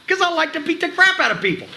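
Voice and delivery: high-pitched, relishing villain boast; over-the-top heel. Shown as a demonstration. Trainer demonstrates a heel relishing violence, over the top. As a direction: play the heel big, pitch way up. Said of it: "over the fucking top a little bit as a heel".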